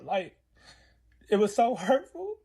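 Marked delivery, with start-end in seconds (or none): 1.3-2.4 high, slowed, choking up; tearful and drawn out; hurt, near tears. Real, not acted. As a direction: let real hurt rise into the voice. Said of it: "I'm feeling like how I feel now".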